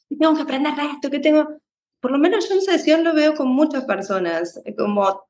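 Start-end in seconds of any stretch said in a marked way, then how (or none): 0.1-1.5 higher and fast, hard on "tengo"; harsh demanding voice. Retold or imitated. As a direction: snap the question at yourself like a strict inner critic. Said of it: "como duramente el decirnos".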